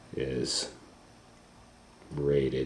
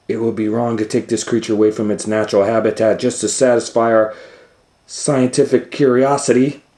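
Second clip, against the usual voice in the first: higher, louder, fast righteous mouse; prim Rosie; 5 semitones higher, 6 dB louder, much faster than usual. Acted. Rosie Rodent scolding the doctor, higher prim voice. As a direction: lecture them primly and quickly.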